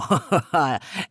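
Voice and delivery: mischievous rising laugh; trickster laugh. Acted. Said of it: "said the lower with a laugh".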